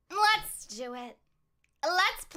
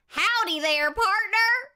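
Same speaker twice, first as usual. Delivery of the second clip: put-on country accent; 7 semitones higher, 4 dB louder than usual. Streamer greets a viewer in a hammed-up country accent. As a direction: greet him like a friendly cowboy. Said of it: "I will speak in a country accent for you".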